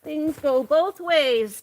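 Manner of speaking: slow, drawn-out mocking repeat; mocking echo. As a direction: echo it back slow and sarcastic. Mocking her words back slowly.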